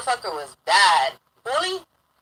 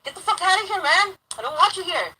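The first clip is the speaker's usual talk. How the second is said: higher-pitched, exasperated telling-off; retold irritation; 7 semitones higher than usual. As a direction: tell off an unwanted visitor, fed up. Retold quarrel, ordering him out.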